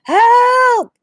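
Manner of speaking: high, loud shout for help. A narrator's reading. Boy shouting again from the hole, loud and high. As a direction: throw it up to the sky again. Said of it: "shouted again".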